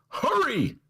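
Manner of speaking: high, urgent mock ad read; hammed-up pleading sales pitch; mock urgency.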